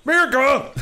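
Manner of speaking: high, loud hammed-up cheer; patriotic yell. Louder repeat of cheer.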